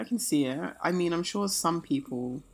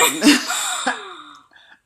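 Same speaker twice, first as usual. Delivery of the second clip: big high laugh at her own joke; sudden loud laugh; loud burst of laughter; 10 dB louder than usual. Real, not acted. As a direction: crack up loudly.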